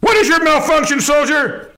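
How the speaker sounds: barking military commander; drill sergeant. Acted. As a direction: shout orders like a drill sergeant. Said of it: "I'm Major Melon".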